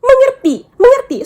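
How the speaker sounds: imitating a stranger yelling Mengerti; mock angry scolding. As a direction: shout it down at her like an angry local. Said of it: "Mereka ninggalin gue gitu aja terus".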